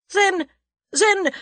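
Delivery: very high, loud sputtering; angry, baffled. A narrator's reading. German at a loss for words, angry. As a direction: splutter as words fail you. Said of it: "angry and baffled".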